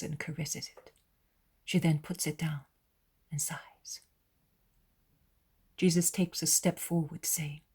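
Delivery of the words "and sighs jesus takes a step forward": plain reading voice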